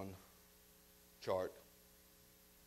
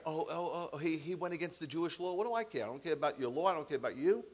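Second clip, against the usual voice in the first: higher, mocking shrug of a voice; scornful Pilate; 5 semitones higher than usual. Pilate brushing off the accusers, higher and scornful. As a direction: wave it off like you couldn't care less.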